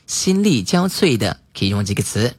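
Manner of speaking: usual speaking voice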